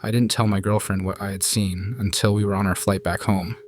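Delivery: plain reading voice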